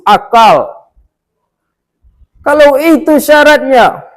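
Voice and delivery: high, forceful angry outburst; indignant high-pitched tirade; high-pitched anger. A narrator's reading. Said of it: "dengan nada tinggi, dengan marah-marah".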